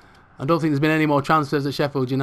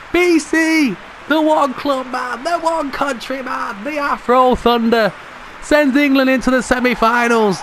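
elated, stretching out each tribute; jubilant goal celebration; 8 semitones higher than usual. Real, not acted. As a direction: hail the goalscorers at the top of your voice. Celebrating the goal just scored.

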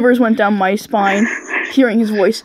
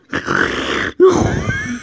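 breathy sigh; 8 semitones higher than usual. Acted. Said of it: "I let out a breathing moans".

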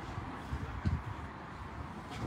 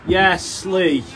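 loud call to a player; 7 semitones higher, 9 dB louder than usual. Real, not acted. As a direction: shout his name across the green. Calling out to player.

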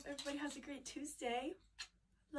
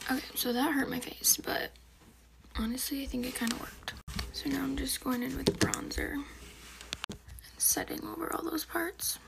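whispering; 4 semitones higher, 8 dB louder than usual. Real, not acted. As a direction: keep it all in a whisper. Whispers the whole video.

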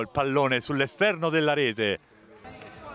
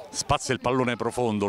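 ordinary talk, nothing marked

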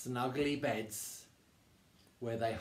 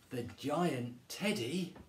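usual speaking voice

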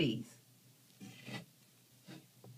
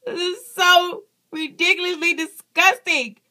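grossed out; 11 semitones higher, 6 dB louder than usual. Real, not acted. Reacting to lizard's foot.

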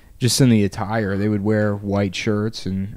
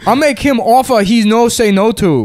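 put-on higher, louder mob tough-guy accent; mobster Italian accent; 8 semitones higher, 4 dB louder than usual. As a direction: challenge him like a movie mobster in Italian accent. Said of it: "What a great accent".

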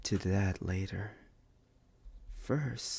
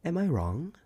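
rhetorical challenge; 8 semitones higher, 7 dB louder than usual. Acted.

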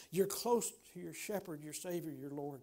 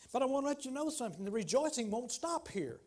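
rising sermon fervor; 3 semitones higher, 4 dB louder than usual. Real, not acted. Preacher building again, louder.

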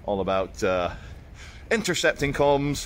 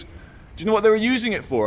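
much higher, quick, teasing build-up; teasing question; 10 semitones higher, much faster than usual. Retold or imitated. Builder teases the vlogger about the screen, higher and quick. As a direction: hurry it out, teasing the punchline. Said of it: "and he goes".

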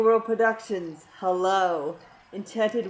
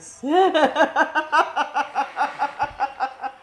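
loud, high burst of giggles; long rattling laugh at your own joke; 6 semitones higher, 7 dB louder than usual. Real, not acted.

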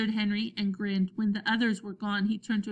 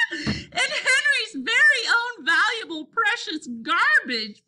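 high, loud, gleeful mockery; laughing jeer; 13 semitones higher, 6 dB louder, slower than usual. A narrator's reading. Robert finishes the joke on Henry, laughing jeer. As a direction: draw out the teasing through laughter. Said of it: "the boys whooped some more".

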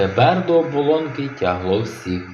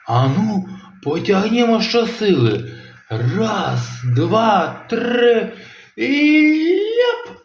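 straining count; 8 semitones higher, slower than usual. Acted. Dad leading the pull, counting up excitedly. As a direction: count rising higher on each number.

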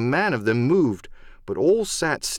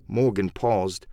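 usual speaking voice

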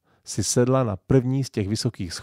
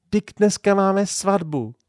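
higher, slow exclaiming protest; cry out; 9 semitones higher, 4 dB louder than usual. A narrator's reading. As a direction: remind him loudly, stretching it out. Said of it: "vzkřikla maminka". (vzkřiknutí, pomalu a výš)

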